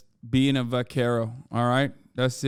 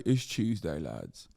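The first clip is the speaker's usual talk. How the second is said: sinks much lower and softer at the end; descending to a deep rumble; 9 semitones lower, 7 dB quieter, slower than usual. Pretend. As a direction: drop down into a deep growl. Repeated Tuesday bit.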